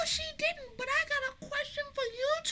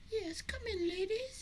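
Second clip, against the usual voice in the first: softly spoken, lowered welcome; put-on suave host; 5 semitones lower, 12 dB quieter than usual. Boy spy ushers puzzled visitors in, put-on suave host. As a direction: usher them in like a smooth secret agent. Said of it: "why are you talking like that?".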